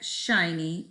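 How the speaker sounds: ordinary delivery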